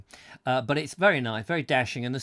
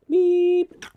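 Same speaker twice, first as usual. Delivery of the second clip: robot beep; 10 dB louder than usual. Pretend.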